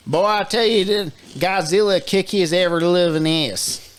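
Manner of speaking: hammed-up Bayou accent, pitched high; horrible bayou accent. Co-host backing Godzilla in a fight, horrible bayou accent. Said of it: "That was a horrible accent".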